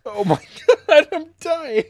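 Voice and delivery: laughing breathlessly. Real, not acted. After a long laughing fit.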